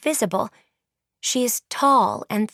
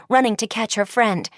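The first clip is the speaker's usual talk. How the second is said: ordinary delivery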